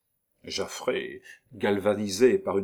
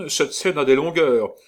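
loud call; 5 semitones higher, 5 dB louder than usual. Acted. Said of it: "ajouta Militaire".

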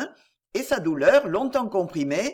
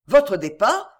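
louder, startled exclamation; surprised cry; 2 semitones higher, 7 dB louder than usual. A narrator's reading. Duke hearing his friend will leave, crying out surprised. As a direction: catch the word in disbelief. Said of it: "s'écria le duc avec l'expression de la plus vive surprise".

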